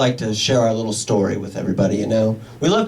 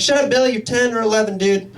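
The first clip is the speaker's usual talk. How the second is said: exasperated; 6 semitones higher than usual. Retold or imitated. Exasperated parent to kid.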